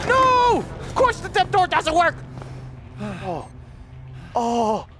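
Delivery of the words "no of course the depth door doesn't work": panicked shout. Real, not acted. Door fails.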